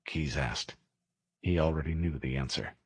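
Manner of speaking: usual speaking voice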